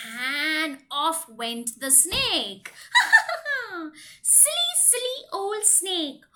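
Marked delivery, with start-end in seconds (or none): 2.9-3.9 laugh. Real, not acted. Laughing at the snake.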